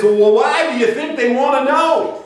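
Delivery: slow, high, loud southern twang through a laugh; laughing southern drawl quoting the coach. Retold or imitated. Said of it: "with his southern twang".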